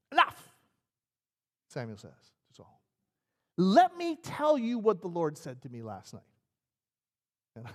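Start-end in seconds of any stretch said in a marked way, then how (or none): 0.1-0.5 sudden loud, high cut-in; interrupting Samuel. Acted. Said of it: "Samuel interrupts his little speech". 3.6-6.2 loud, slow, forceful declaration; stern confrontation. Acted. Samuel cuts Saul off, confronts him.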